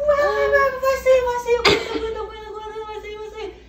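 high, frantic retold labour cries; mock panic. Passenger acted out as baby is coming, mock panic.

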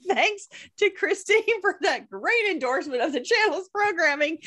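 high, laughter-filled mock thanks; laughing while talking. Real, not acted. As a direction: get the thanks out through laughter. Laughing over backhanded endorsement.

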